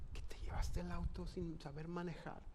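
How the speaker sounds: soft, hushed whisper; whispered question. Retold or imitated. Father whispering to his half-asleep son at night, hushed whisper. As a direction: whisper it so nobody else wakes.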